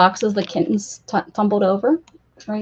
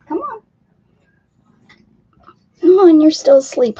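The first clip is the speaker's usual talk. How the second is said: coaxing; 5 semitones higher, 9 dB louder than usual. Real, not acted. Coaxing sleepy cats.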